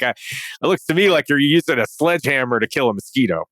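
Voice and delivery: put-on a fairly light Boston accent, dry and skeptical. Recalling the Boston contractor's sledgehammer verdict, imitated Boston accent. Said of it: "It was a Boston guy".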